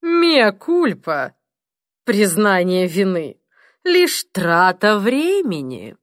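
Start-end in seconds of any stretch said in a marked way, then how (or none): 0.0-1.3 sneering confession. Acted. Benchley's cynical remark.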